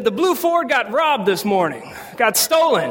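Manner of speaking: high, drawn-out gleeful announcement; excited. Retold or imitated. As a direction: play dad delighted to share the news. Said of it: "And he's excited".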